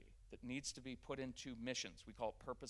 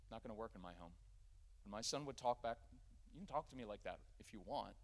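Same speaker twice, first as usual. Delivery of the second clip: softer, lower, matter-of-fact limit; calm no-drama; 3 semitones lower, 7 dB quieter than usual. Shown as a demonstration. Father answering the son's back talk, soft and matter-of-fact. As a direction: set the boundary quietly, no heat. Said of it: "I have no problem at all being like".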